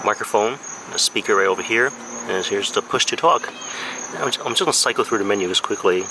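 usual speaking voice

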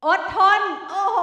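high, loud shouted quiz answer; real excited shout. Real, not acted. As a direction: call it out so the teacher hears. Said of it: "เราให้เขาได้ยินเสียงเขานะคะ".

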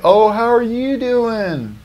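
high, slow, sugary greeting; fake-nice dentist. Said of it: "It's like".

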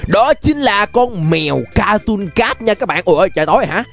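normal talking voice

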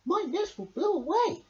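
high little bird voice; high chattering worry; blabbing bird. A narrator's reading. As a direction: fret out loud like a small bird. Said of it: "blabbed the bird".

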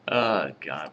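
ordinary talk

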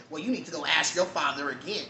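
imitating a coworker's sharp comeback; a touch of mad, snippy tone; snippy coworker, lightly voiced; mock angry retort. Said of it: "why are you so mad".

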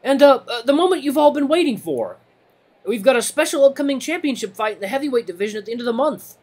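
high, halting timid voice on the mic; nervous rabbit announcer. Acted. Timid rabbit addressing the waiting crowd, nervous and high. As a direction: push through a public announcement nervously.

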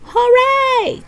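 high, loud hooray; cheering. Acted. Crowd welcomes the idea of building on, cheering. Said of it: "cheered the crowd".

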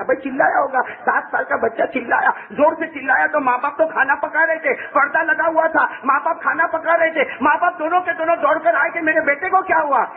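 high, urgent sermon voice; full-force preaching. Acted. Preacher telling how the parents ran to their son, high and urgent. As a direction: pile the phrases up in alarm.

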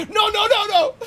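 high, loud yelling of no; panicked shout. Real, not acted. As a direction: yell in real alarm. Dog runs in, gamer panics.

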